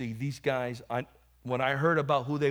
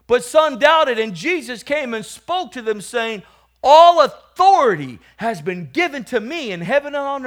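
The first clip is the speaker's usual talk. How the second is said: loud, high scripture reading; scripture preaching; 10 semitones higher, 12 dB louder than usual. Acted. Preacher reading the disciples' commission to the church, forceful scripture reading. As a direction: proclaim the passage with raised force.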